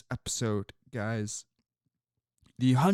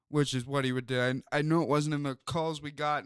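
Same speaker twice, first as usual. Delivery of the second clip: normal talking voice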